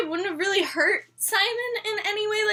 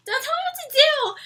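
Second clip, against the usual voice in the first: higher-pitched imitation of a stubborn retort; mock defiance; 10 semitones higher than usual. Clary answers the Inquisitor and Jace, mock defiant retort. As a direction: throw it back defiantly, pitched up.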